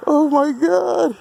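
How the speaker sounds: high, slow, awed oh my God; amazed. Real, not acted. Staring at the tire smoke, amazed and high-pitched. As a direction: let the wonder stretch it out.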